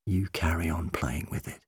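low, very soft murmur; whisper. A narrator's reading. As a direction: confide softly to a child. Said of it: "he whispered".

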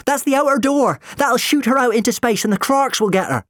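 high, loud, alarmed warning; frantic protest. A narrator's reading. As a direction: shout it in furious objection. Jim furiously objects, lever about pulled.

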